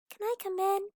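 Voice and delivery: high, small lamb asking politely; a tiny lamb at the door, pitched well up; little lamb voice. Acted. Lamb asks the wolf to come in, high and small. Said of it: "the little lamb asked".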